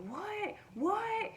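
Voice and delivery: high, startled "What? What?"; shrill startled double what; panicked. Retold or imitated. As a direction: mimic a guy panicking on the phone. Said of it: "like, panicked".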